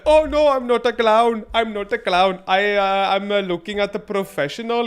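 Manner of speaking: mock denial. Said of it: "As if I'm supposed to be like".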